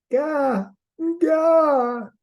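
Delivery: put-on high babbling baby cry; baby Raven voice. A narrator's reading. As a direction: squeal it like a demanding baby. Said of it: "He cried and cried".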